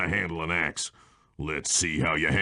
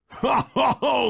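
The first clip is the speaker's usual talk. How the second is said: high, rollicking ho-ho-ho; jolly gloating laugh; gleeful belly laugh. Acted. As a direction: burst into self-satisfied laughter. Delighted after his climb.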